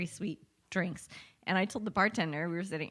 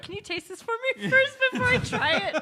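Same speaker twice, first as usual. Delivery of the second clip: mock fussy friend; 4 dB louder than usual. Teasing voice of friend Jonathan.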